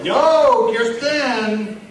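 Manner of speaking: loud, high holler to the bartender; calling out. Acted. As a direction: hail her boisterously across the bar. Character hails the bartender across bar.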